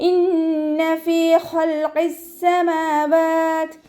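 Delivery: slow, high melodic tajweed chant; chanted Quranic verse, drawn out; Quran recitation. Shown as a demonstration. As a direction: stretch each syllable in a ringing recitation. Teacher recites verse, then explains.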